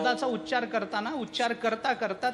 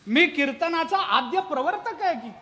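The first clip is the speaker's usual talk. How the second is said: laughing sage; 3 semitones higher, 4 dB louder than usual. Retold or imitated. Narad laughs at the robber.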